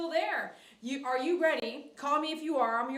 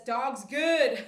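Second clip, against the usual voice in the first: "Dog's good" read through a laugh; 4 dB louder than usual. Real, not acted. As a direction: giggle while reading the comment. Reads chat line laughing.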